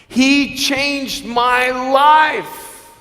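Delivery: high, drawn-out proclamation; ringing, very slow emphasis; impassioned declaration. Real, not acted. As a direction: stretch every word with full conviction. Climax of motivational story.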